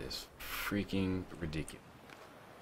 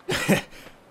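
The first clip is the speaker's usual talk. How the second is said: short laugh; 7 dB louder than usual. Real, not acted. Reacting to laughing enemy.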